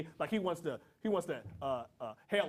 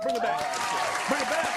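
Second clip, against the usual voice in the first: loud, higher hype call; 3 semitones higher, 5 dB louder than usual. Real, not acted. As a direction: pump up the crowd. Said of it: "Come on, Chuck".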